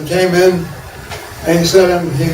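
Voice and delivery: ordinary talk, nothing marked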